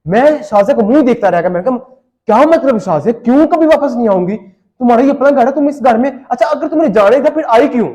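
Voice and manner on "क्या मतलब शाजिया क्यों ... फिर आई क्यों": incredulous protest. Retold or imitated. Stunned husband confronts wife who is leaving.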